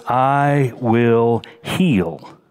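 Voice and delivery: slow solemn. Acted. Preacher voices God's promise.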